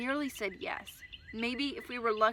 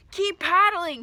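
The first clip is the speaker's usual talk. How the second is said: loud, much higher command; shouting; 8 semitones higher, 11 dB louder, slower than usual. Acted. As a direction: order him on over the storm. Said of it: "he shouted".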